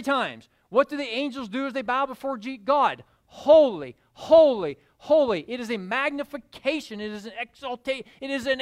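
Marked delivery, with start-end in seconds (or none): 3.3-5.4 loud declaimed holy, holy, holy; angelic proclamation. Acted. Angels bowing before God, proclaiming loudly.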